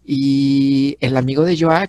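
ordinary talk, nothing marked

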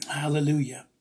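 ordinary delivery

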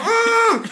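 high strangled yelp of pain; sudden high mock scream of pain; muffled pain yell. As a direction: cry out into a towel as the needle goes in. Retold pain into towel.

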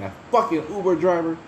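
high, mock furious outburst; mock angry rant. Retelling how he ranted at the Uber driver, hammed-up anger. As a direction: replay the fury at the driver. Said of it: "I was so mad".

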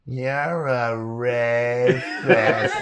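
very slow, drawn-out deadpan; slow mock. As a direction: stretch it out, laughing. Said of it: "One of us will go".

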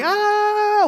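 high, loud mock cry of alarm; mock-horrified high "aah"; panicked parent's cry. Parent seeing the kid fall, high mock shriek. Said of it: "if they see you going".

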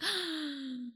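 soft breathy gasp; quick imitated gasp; mock gasp. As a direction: act out being shocked. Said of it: "And I was like".

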